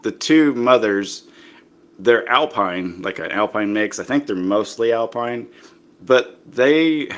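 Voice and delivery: usual speaking voice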